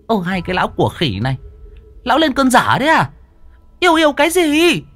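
scolding wife. A narrator's reading. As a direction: tear into the two fools.

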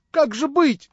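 high, loud, frightened outcry; frightened cry. A narrator's reading. Natasha frightened, crying out to Vanya for help, alarmed. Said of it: "вскричала она испуганная".

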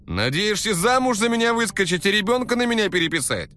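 loud, high shaming rant; shaming scold. Acted. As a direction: scold her loudly. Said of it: "начал стыдить её Валера".

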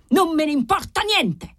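the marquis loses his temper and screams it; yelled. A narrator's reading. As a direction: explodes at the servant, high and hard. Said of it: "urlò Formosa".

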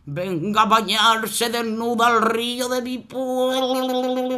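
slow, odd river voice; river making a cheeky wish. Acted. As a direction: draw out the demand lazily. River makes odd demand.

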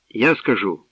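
usual speaking voice

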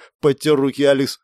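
plain reading voice

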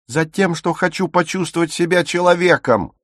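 high, impassioned protest. A narrator's reading. Dagmar answering why she risks going out, almost shouting. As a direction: burst out, voice climbing. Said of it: "«Не ори!»".

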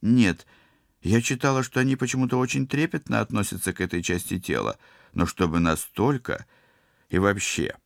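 defensive explanation with a touch of embarrassment; flustered self-justifying. A narrator's reading. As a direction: justify yourself, a little flustered. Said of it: "стала смущённо оправдываться".

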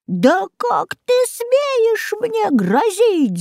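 very high, slow witch screech; witch shrieking. A narrator's reading. Baba Yaga screeching back at the threatening goose. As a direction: screech it like an outraged hag. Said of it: "завопила Яга".

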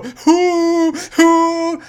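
loud high hooting; kid's hoot. Retold or imitated. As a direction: hoot instead of laughing. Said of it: "he'd go".

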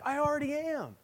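high-pitched imitation of an upset preschooler; mock a little girl's indignant, high protest; defensive child. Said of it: "She got all upset and defensive".

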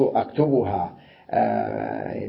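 ordinary talk, nothing marked

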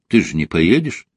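ordinary delivery, nothing marked

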